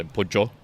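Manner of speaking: pollo copied as "POOD-jo"; imitated j-sound pollo. Imitating co-host's way of saying pollo, j sound. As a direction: repeat "pollo" with a hard j in the middle. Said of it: "and you say pollo".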